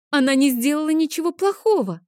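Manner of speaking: put-on high, tearful little girl; upset child's wail; crying protest. A narrator's reading. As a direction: plead the doll's innocence in a high child voice. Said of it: "Адель вновь заплакала".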